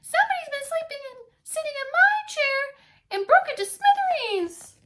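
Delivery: very high loud upset cub voice; Baby Bear finding his broken chair; squeaky Baby Bear. Acted. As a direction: wail it like a little cub.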